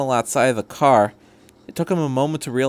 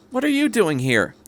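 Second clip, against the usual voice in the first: surprised, higher outburst; exclaiming; 9 semitones higher than usual. A narrator's reading. Woman startled by the agent's badge, exclaiming. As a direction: blurt it out in shock. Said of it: "Missus Yamashita exclaimed".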